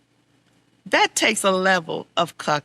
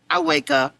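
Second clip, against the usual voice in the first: normal talking voice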